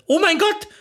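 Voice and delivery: mild high-pitched exclamation; narrator's understated shriek; startled cry. A narrator's reading. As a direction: cry out in sudden alarm, lightly acted. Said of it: "schrie ich auf".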